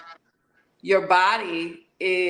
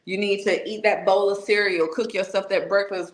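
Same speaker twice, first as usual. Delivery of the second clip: ordinary delivery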